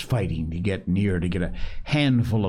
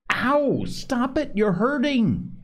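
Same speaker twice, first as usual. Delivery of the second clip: high, yelping protest; a boy suddenly really hurt; cry of pain. A narrator's reading. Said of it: "then in real pain".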